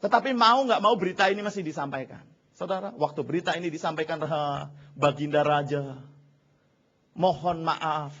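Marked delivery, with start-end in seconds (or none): 4.2-8.1 pelan-pelan, tersendat penuh hormat; hamba memohon ampun. Acted. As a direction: meniru hamba ragu menghadap raja. Pengawal menyampaikan kabar kepada raja. (hamba memohon dengan lambat dan rendah)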